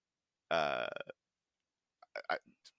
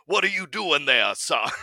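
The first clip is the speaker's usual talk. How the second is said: much higher, louder Southern cartoon rooster voice; Foghorn Leghorn; 7 semitones higher, 9 dB louder than usual. Imitating a castmate's Sanji line, a loud Southern rooster voice. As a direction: boom it out like a blustery Southern rooster. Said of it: "sounded like Foghorn".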